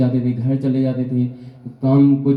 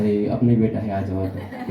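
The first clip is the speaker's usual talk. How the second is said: softer, lower, fatherly coaxing with a laugh; fond father; 6 semitones lower, 4 dB quieter than usual. Retold or imitated. Father welcoming his scolded son back, soft and fond. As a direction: call the son back in kindly.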